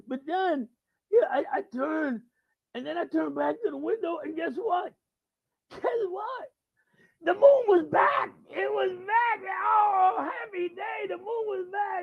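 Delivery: put-on high drunken shout, building to elation; wasted rocker. Wasted guy announcing the moon's return, elated high shout. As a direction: build to a euphoric drunken shriek. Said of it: "blitzed out of his mind".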